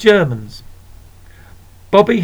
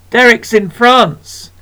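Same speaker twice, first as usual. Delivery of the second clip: slow, higher, proud announcement; proud importance; 7 semitones higher, 4 dB louder than usual. Acted. Sister telling the shopkeeper, slow and proud. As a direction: say it like big news. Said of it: "note of importance entering her voice".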